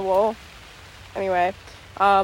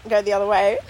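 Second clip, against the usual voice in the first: normal talking voice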